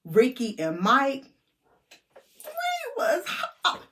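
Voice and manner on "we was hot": giggling it out, high; laughing. Real, not acted. Telling friends about the party, laughing. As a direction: laugh through the words.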